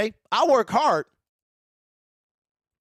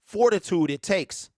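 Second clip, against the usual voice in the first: normal talking voice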